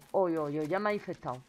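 ordinary talk, nothing marked

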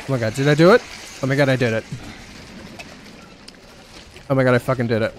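loud, thrilled disbelief; excited. Real, not acted. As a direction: cheer it out loud. Solved the puzzle.

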